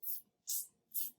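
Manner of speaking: whispered teasing tss tss; monkeys' tss. Acted. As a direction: hiss like cheeky monkeys. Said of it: "the monkeys ... said".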